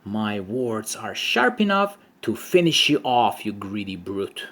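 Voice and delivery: usual speaking voice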